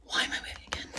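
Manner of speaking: unvoiced-breath whisper to oneself. Real, not acted. Streamer puzzling to herself why she is waving, whispered. Repeats own question under breath.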